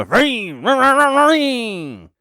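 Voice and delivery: high, loud sung ring ring like a phone; phone ring imitation. Comic imitating a ringing phone to end the bit, high and loud.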